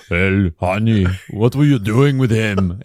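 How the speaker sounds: put-on husband grilling his wife in Austrian accent; Arnold Schwarzenegger impression. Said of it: "if you were married to Arnold Schwarzenegger".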